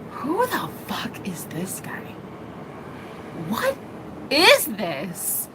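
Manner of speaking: slow, disbelieving whisper; hushed inner disbelief, acted out; stunned whisper. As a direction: whisper it slowly, as a dumbfounded thought. Acting out her stunned inner reaction.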